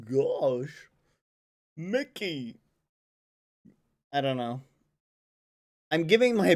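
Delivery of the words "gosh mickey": put-on cartoon goof voice, sliding pitch; Goofy. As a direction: exclaim it as a dopey cartoon character. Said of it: "Goofy would be like".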